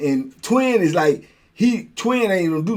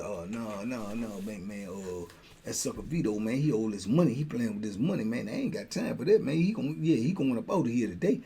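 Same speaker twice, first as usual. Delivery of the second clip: put-on lower, softer young hustler griping; youngster voice; 7 semitones lower, 11 dB quieter than usual. Youngster complaining to him about Vito, low and soft. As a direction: mutter it low like a jaded youngster.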